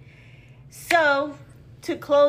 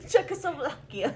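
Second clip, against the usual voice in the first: laughing; 4 semitones higher than usual. Real, not acted. Repeating the country name laughing.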